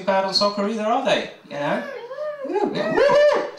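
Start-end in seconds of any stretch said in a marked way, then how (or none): 2.4-3.6 very high whooping cheer; excited cheer. Acted. Comedian cheering Ireland's soccer team, very high whoop. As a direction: whoop like a fan at a goal.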